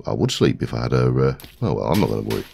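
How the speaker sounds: ordinary talk, nothing marked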